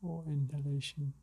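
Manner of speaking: calm, unhurried meditation guide; spacious, settled relaxation-exercise delivery; guided meditation. Acted. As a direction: ease them into slowing the breath, with long pauses.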